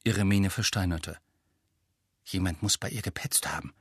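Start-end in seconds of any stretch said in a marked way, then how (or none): 2.2-3.7 low, quiet anger; angry. A narrator's reading. Ron suspecting a tattletale, angry but held down. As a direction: keep the anger down in a soft, low voice. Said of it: "sagte Ron zornig".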